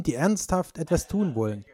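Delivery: ordinary talk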